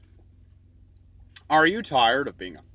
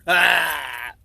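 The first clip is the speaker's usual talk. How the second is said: ordinary talk, nothing marked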